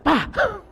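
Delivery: high, sudden "bah" sound effect; imitated slam noise. Host cramming clutter in the closet before guests, comic slam noise. Mimics shoving mess into closet.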